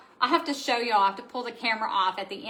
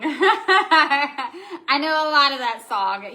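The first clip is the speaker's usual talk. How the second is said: slow, louder, through laughter; laughing; 3 semitones higher, 5 dB louder, much slower than usual. Real, not acted. Host admitting to a viewer she knows the song, laughing. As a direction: laugh your way through the admission. Laughing about knowing the rap lyrics.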